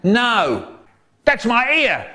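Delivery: high, pained protest cries; shouting. A narrator's reading. Inspector fights off his attacker, high shouting. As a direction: yelp as if being grabbed. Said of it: "someone shouting".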